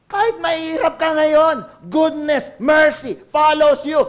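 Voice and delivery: loud, rising, slowing preacher's cry; loud preaching build; fiery preaching. Acted. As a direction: proclaim it over the crowd. Preaching at full force.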